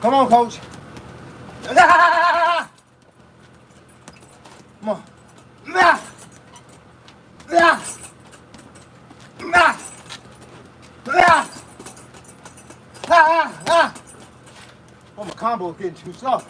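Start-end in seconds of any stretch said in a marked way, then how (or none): 1.7-2.7 high, stuttering burst of effort; long exertion cry. Real, not acted. Boxer pushing himself through a combo, straining cry. 5.7-14.0 short, higher-pitched "ah" with each punch; sharp bursts of effort on every strike; punch exertion cries. Real, not acted. Boxer throwing punches in training, effort cries. Punching through a workout round.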